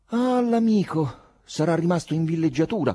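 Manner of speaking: high, loud character voice; thick-voiced woman writer. A narrator's reading. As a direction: comment loudly in a thick, high voice. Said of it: "commentò con voce densa Nastasia".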